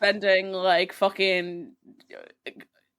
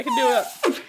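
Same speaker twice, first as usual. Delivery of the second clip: trumpeting like an elephant; elephant noise. Shown as a demonstration. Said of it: "Making, like, an elephant noise".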